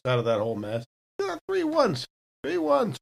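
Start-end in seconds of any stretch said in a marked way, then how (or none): 1.2-2.0 high, deflated disbelief; dismayed disbelief. Real, not acted. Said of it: "What the heck?". 2.4-3.0 high, deflated disbelief; dismayed disbelief. Real, not acted. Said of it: "What the heck?".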